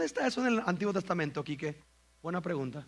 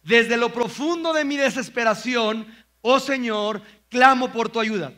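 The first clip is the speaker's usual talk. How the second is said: loud, higher, slow psalm reading; solemn scripture reading; 4 semitones higher, 7 dB louder, slower than usual. Shown as a demonstration. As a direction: read it as a heavy lament. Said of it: "Chécate lo que dice". (lectura solemne del salmo, lenta y fuerte)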